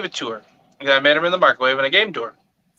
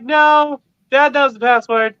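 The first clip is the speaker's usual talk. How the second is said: mock child refusal; 9 semitones higher, 3 dB louder, slower than usual. Daughter refusing dad.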